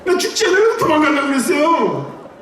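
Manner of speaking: complaining to God with a high, laughing mock whine. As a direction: squeal it out half laughing.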